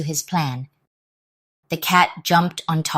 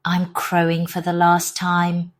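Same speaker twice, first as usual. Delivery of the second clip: usual speaking voice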